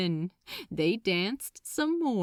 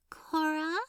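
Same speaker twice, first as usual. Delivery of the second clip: put-on a light, higher shy child's voice; shy little girl; 6 semitones higher, 3 dB quieter than usual. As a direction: say it shyly like a five-year-old, and higher. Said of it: "she told him shyly".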